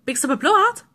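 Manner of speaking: high, loud singsong yoo-hoo; mimicking notification. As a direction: chirp it like a relentless phone alert. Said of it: "all he's gonna hear all day long".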